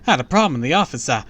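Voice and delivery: loud, high, very fast excuse; flustered teacher. A narrator's reading. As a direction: rattle it off as a hurried teacher. Teacher walks in on chaos.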